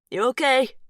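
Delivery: calling out loud over the wind. Acted. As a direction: raise the voice to carry over the storm. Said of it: "he calls".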